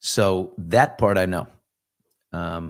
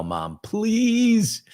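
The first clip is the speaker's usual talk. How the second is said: high, drawn-out pleading whine; mock wheedling to a parent; begging child; 12 semitones higher than usual. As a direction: stretch the please way up like a kid begging. Acts a child begging mom.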